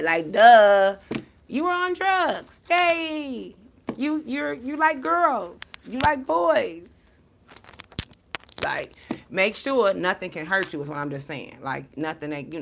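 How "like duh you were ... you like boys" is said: mock sarcasm. Mocking the haters' insults.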